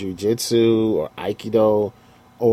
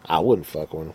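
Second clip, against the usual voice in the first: normal talking voice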